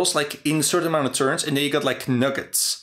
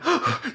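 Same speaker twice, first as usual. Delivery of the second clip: mock gasp; 12 semitones higher than usual. [name] bursts in mid-scene.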